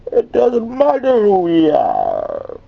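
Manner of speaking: high, loud, stretched-out villain proclamation; Bane. Shown as a demonstration. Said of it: "sound effect for me".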